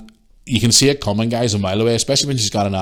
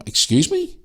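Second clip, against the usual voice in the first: affronted disbelief; slower than usual. Retold or imitated. Retells own affronted reply.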